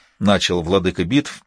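ordinary delivery

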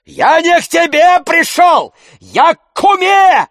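very high, loud indignant yell. A narrator's reading. Tarantyev refusing to leave Oblomov's house, yelling. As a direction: yell back at full voice. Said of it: "вопил Тарантьев".

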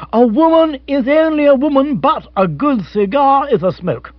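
rally him with booming, misplaced wisdom; bombastic pep talk. Acted. Rory's pep talk to Bill.

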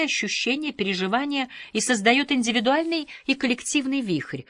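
plain reading voice

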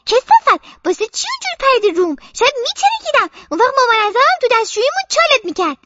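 put-on a child scolding her friend for jumping on her; angry child. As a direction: tell her off, speeding up at the end.

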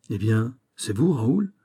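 ordinary delivery

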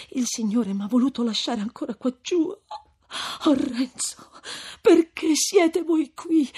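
distressed female character, voice climbing; tearful lament. A narrator's reading. Lucia distressed that Renzo came.

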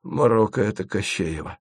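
a frightened hoarse warning; fearful rasp. A narrator's reading. Asen warns of Koschei's spell, hushed and afraid. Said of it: "прохрипел он".

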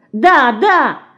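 put-on bright eager girl's agreement; joyful. A narrator's reading. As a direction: burst out gladly. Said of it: "радостно закивала головой Динка".

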